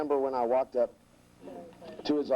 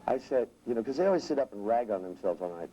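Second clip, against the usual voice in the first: normal talking voice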